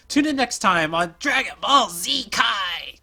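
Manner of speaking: slow, loud hammed-up announcer shout; anime announcer. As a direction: boom it like a TV narrator. Announcer parody.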